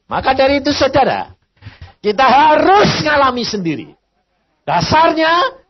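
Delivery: loud, high, driving exhortation; preacher shouting to the church; fiery preaching. Real, not acted. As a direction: hammer the point home to the congregation. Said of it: "preaching at full force".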